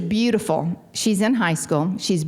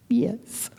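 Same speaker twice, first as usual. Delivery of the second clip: whispered yes; 5 semitones higher than usual. Retold or imitated. Desperate yes to AA meeting.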